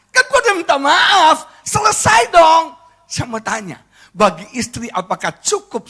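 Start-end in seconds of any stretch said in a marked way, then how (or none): 0.1-2.8 high-pitched protest, stretched stressed words; exasperated husband. Acted. Husband who already apologised, protesting in exasperation. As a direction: insist the matter is closed, voice raised high.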